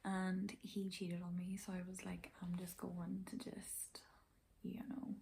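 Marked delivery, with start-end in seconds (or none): 0.0-1.6 hushed, half-voiced whisper; confiding whisper about the ex; whispered aside. Real, not acted. 2.4-5.1 whisper. Retold or imitated. Retold own thought, hushed.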